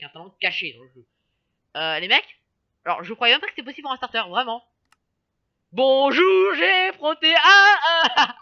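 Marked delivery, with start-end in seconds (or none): 5.7-8.4 excited laughing. Real, not acted. Excited about hidden ability.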